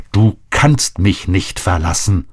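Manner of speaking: usual speaking voice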